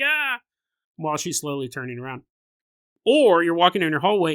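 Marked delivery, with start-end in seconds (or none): 0.0-0.4 a high, mock-frightened "Ah!"; mock startled yelp. Seeing the ghost walk backwards toward you, mock yelp. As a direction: jump at the ghost walking backwards. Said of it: "You're like".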